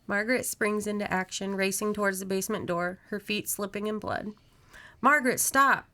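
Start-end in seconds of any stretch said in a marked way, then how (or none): 5.0-5.9 loud, high warning shout; yelled command. A narrator's reading. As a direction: yell to halt someone in danger. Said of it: "Jones yells".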